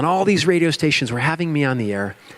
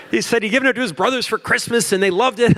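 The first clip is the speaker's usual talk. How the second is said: rising panic; 9 semitones higher than usual. Retold or imitated. Panic while host praises club.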